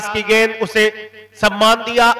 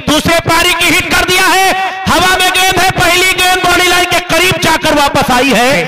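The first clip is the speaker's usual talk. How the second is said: rising, louder call of a big hit; excited cricket commentary; 6 semitones higher, 3 dB louder than usual. Real, not acted. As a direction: build up as the ball flies. Big hit, ball in air.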